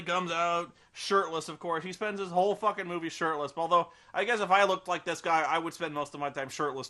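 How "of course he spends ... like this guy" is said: ordinary delivery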